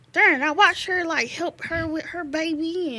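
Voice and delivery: frustrated thought blurted out high; exasperated inner voice. Retold or imitated. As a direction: vent it like a sigh of disbelief. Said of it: "'cause I'm like".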